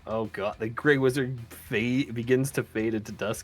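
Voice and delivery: usual speaking voice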